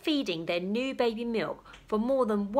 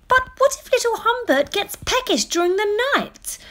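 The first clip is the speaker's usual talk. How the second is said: much higher, louder worried mother; 13 semitones higher, 7 dB louder than usual. Acted. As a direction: plead for your baby. Mother pleads.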